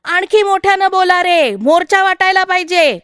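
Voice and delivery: loud, high-pitched call to the crowd; child leader yelling encouragement; rallying shout. A narrator's reading. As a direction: shout to rouse the others. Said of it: "एक जण ओरडला".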